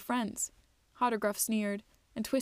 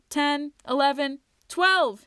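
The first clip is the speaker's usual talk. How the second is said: slow then rising to a loud, much higher scream; screaming the count; 5 semitones higher, 7 dB louder, slower than usual. A narrator's reading. Twig screaming the final count as he dunks, loud. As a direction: build to a triumphant shout on twelve. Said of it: "he screamed".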